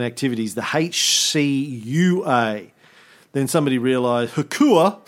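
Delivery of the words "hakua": Hakua sounded out like Hakuna Matata; acronym said as a word. Shown as a demonstration. Host sounding out the committee's initials, Hakua as one word. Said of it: "it sounds like Hakuna Matata".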